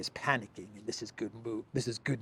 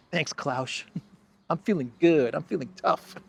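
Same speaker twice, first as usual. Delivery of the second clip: laughing, higher mock swagger; mock proud Rutte; 4 semitones higher than usual. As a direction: gloat like a kid who feels tough. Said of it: "he called Klaus Schwab".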